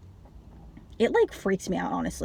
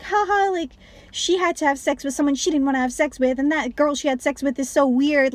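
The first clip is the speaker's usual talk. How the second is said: high, snickering mock voice, way up; mocking the audience; 6 semitones higher than usual. Mocking theater audience laughing at Lou, high snickering voice. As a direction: sneer at them as clueless gigglers. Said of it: "people in the theater were laughing and being like".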